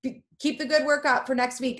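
ordinary delivery, nothing marked

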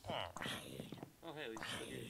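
soft, whispered aside; hushed relief. Real, not acted. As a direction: breathe it out quietly. Nervous relief, spider still there.

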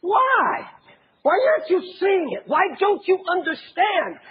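high, pleading cry of frustration; exasperated. Retold or imitated. Preacher voicing Jesus at the blind crowd, exasperated and pleading. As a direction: plead like a heartbroken teacher. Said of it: "this exasperation that Jesus seems to have".

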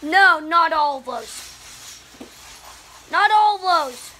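loud, slow, pleading no; loud protest. Real, not acted. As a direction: beg them to stop, loudly. Protesting the pile of Legos.

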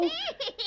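high nervous titter; nervous laugh. Acted. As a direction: laugh in panic. Dragon panics.

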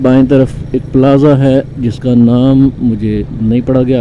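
ordinary delivery

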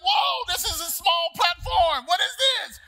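high loud shouted panic; yelling in alarm at the tiny platform; panicked shout on seeing the small platform; terrified exclaim. Retold or imitated. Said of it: "and it's like".

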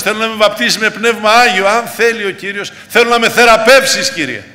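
loud, high-pitched pulpit pleading; fervent preaching. Acted. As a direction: build a fervent appeal, phrase after phrase. Preacher pleads at full force.

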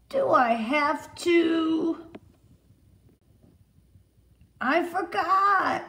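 put-on high, slow little boy pleading; a small boy whining excuses, drawn out; David's excuses. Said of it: "he always says".

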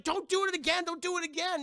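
high, loud frantic plea; panicked warning. Retold or imitated. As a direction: warn them in an imitated squeal of alarm. She warns them off the gong.

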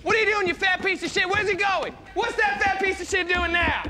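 ordinary delivery

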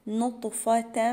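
نطفة slowly with a bounced, echoing taa. Shown as a demonstration. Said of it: "حرف الطاء مقلقل". (قلقلة الطاء في نطفة)

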